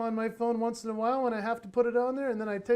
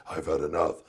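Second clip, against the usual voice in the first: very low monster-like dad voice; exaggerated deep, exasperated voice; mock fed-up growl; 4 dB louder than usual. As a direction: growl it deep as if patience snapped. Dad's exasperated thought acted out.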